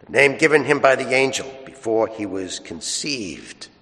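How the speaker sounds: solemn, slow scripture reading; Gospel reading. Acted. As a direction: proclaim it from the ambo.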